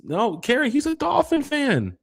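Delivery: high, drawn-out teasing call to Carrie. Real, not acted. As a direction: sing it up high, slow and mocking. Teasing Carrie about her team.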